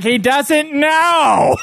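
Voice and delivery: high, slow shout, know stretched; exasperated yell. Retold or imitated. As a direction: defend him loudly. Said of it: "It's like".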